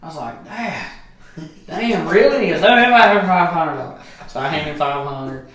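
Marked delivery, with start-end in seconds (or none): 0.4-2.5 rising, louder disbelief; shocked son questioning his dad; surprised protest. Retold or imitated. Son to dad, asking for his money: loud disbelief. 2.6-4.0 louder, higher pushy request; insistent demand. Retold or imitated. Drunk dad to son, demanding cash: louder, insistent.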